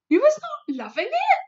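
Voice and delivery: high, rising, mock innocent; innocently. A narrator's reading. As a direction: play innocent after the smash. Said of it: "asked innocently".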